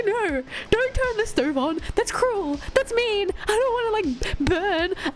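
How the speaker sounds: mock-earnest high plea; high pleading protest. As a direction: beg them not to hurt it. Pleading for the spider.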